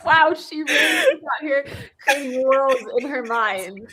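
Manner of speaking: slow, laughing comment. Real, not acted. Friend laughing about the niece's imagination. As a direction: say it while laughing.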